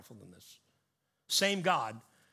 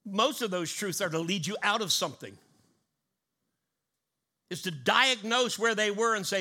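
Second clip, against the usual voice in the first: loud, high, building preacher cry; full-force preaching; 6 semitones higher, 6 dB louder than usual. Acted. Pastor to congregation, preaching at full force. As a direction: drive each contrast home to the church.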